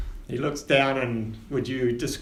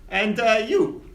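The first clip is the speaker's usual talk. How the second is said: higher, grand ship captain; captain Winters; 3 semitones higher than usual. Acted. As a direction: welcome passengers aboard with flourish. Captain checks tickets.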